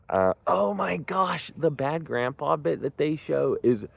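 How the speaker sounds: high, slow oh my gosh; bursting with delight at the memory; excited gush. Real, not acted. Fan raving to viewers about the bad grandpa bit, excited high gush.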